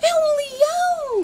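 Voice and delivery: high, loud panicked cry of fear; frightened scream. A narrator's reading. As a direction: scream it in terror. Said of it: "gritou o Oscar, assustado". (grito assustado e agudo)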